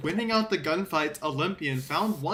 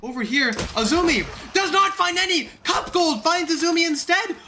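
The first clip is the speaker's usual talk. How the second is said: hype casting; 6 semitones higher than usual. Real, not acted. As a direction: call the big play at full hype.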